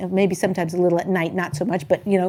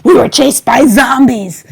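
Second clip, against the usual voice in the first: put-on kid's zombie story; 4 semitones higher, 9 dB louder, slower than usual. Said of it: "He said".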